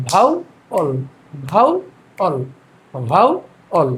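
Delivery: "Vowel" as rising bhau, pause, falling ol; syllable by syllable. Shown as a demonstration. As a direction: drill "vowel" in two separated beats. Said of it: "আমরা ভেঙে ভেঙে". ("Vowel" ভেঙে ভেঙে, দুই সিলেবলে)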